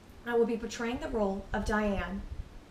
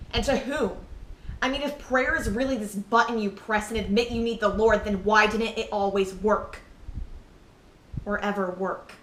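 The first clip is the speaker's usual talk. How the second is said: louder, agitated challenge to faith; indignant questioning; 5 dB louder than usual. Acted. Questioning why prayer never worked, indignant and loud. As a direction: demand an answer, voice raised. Bitter questioning in dramatic monologue.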